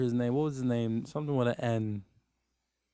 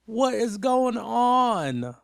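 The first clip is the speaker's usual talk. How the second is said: higher, slow, drawn out in disbelief; exasperated drawn-out question; 7 semitones higher, 3 dB louder, much slower than usual. Real, not acted. Reacting to Debbie's choices, slow high disbelief. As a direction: stretch it out like you can't believe it.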